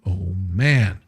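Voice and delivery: usual speaking voice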